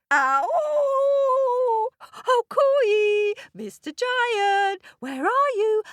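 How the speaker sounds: high, loud calling out; shouting up. Acted. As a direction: call up the giant's throat. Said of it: "And then he shouted".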